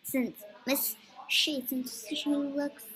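slow, loud gushing coo. Real, not acted. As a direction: stretch it out adoringly. Cooing over cute avatar.

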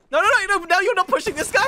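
high, loud frantic protest; frantic calling out. Real, not acted. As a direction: call urgently to a teammate mid-fight. Frantic calls to teammate mid-fight.

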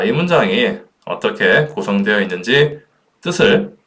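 ordinary talk, nothing marked

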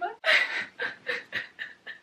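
squeaky unvoiced giggle; airy high-pitched laugh; high breathy laugh. Real, not acted. Overwhelmed reaction.

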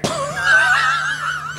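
high whooping cackle; shrieking laugh. Acted. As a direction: shriek with laughter at your joke. Host shrieks at own joke.

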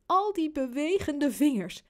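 usual speaking voice

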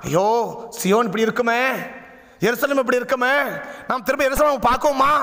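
a touch of acted grief, pitched low; mild mock lament. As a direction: lightly act out someone crying alone for home. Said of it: "author உட்காந்து தனியா அழுறான் போய்".

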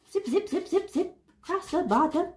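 high playful zip zip zip; sing-song zipping across; playful zip sounds. Shown as a demonstration. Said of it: "I have to sew them together".

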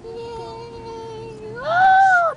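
high, loud cheering; excited cheer. Real, not acted. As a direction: whoop with delight. Arriving at market.

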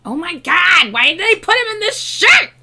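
ordinary delivery

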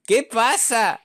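high, slow, laughing put-on voice; laughing mock whine. Gamer complaining to the opponent through laughter. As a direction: stretch it out while laughing.

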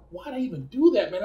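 high, exasperated self-scolding; scolding himself. Retold or imitated. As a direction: berate yourself in disbelief at your own stupidity. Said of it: "I'm like".

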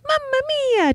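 high mock Italian accent; playful sing-song Italian accent; Bonnie Prince Charlie. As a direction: drop in a squeaky cartoon Italian accent. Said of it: "I picture Bonnie Prince Charlie talking like".

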